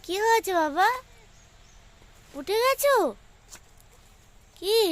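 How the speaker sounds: high, loud call across the field. Real, not acted. Mother calls loudly to her just-woken child across the field.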